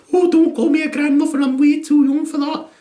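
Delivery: high, a touch of mock vanity; indignant young gran. Mum refusing to be called grandmother, high indignation. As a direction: protest like a gran who feels too young.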